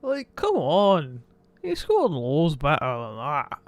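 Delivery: mock Scottish accent, slow; lightly done Scottish brogue. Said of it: "My Scottish accent has gotten a lot better".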